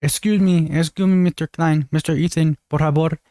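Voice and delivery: mocking a Mexican housekeeper's accent. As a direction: parody a Spanish-speaking housekeeper, lightly. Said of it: "let's pretend that's not racist".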